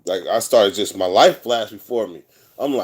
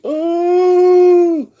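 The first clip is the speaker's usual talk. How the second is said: falsetto ooh; 6 dB louder than usual. Retold or imitated. Wistful moment hits in prison.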